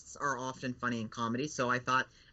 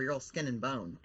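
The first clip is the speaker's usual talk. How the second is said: normal talking voice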